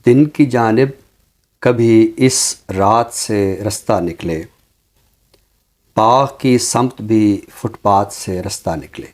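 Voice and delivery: slow, measured recital of Urdu verse; ghazal recitation. Acted. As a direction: savor each line like a mushaira reading. (धीमी, ठहरी हुई ग़ज़ल-ख़्वानी)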